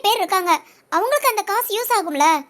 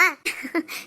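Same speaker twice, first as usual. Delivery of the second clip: soft laugh; 6 semitones lower, 8 dB quieter than usual. Acted. Mother-in-law laughs happily.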